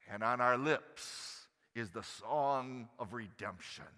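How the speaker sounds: normal talking voice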